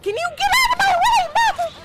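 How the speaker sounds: fast, high furious shout; angry yell. Real, not acted. Player yelling at a character in her way, furious. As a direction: bark it at whoever blocks you.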